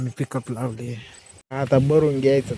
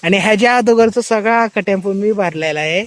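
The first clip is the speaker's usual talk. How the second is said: raised, indignant protest; loud protest; 8 semitones higher, 8 dB louder than usual. Real, not acted. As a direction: call out the lie loudly. Teasing protest about who loaded.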